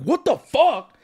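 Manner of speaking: mock-serious angry outburst; serious outrage. Shown as a demonstration. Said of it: "there wasn't a point where I was like".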